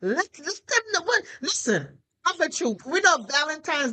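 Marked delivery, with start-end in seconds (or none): none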